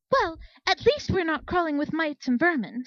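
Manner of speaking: a haughty unicorn snapping back at soldiers; indignant sputter. A narrator's reading. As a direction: sputter it out in outrage. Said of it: "Speck sputtered indignantly".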